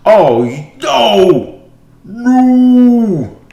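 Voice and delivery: high, loud despairing cries of no; real dismay, shouting out; anguished shout. Real, not acted. Opponent springs a winning attack.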